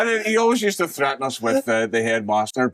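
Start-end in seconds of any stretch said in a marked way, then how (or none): none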